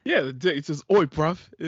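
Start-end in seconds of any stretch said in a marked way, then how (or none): 0.9-1.4 loud mock roadman "Oi, bruv"; rough London slang. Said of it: "It says bruv a lot".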